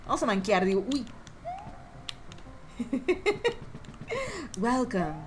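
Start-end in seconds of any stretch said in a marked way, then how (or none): 4.6-5.2 mock announcer. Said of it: "Welcome, streaming major station".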